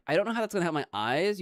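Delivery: normal talking voice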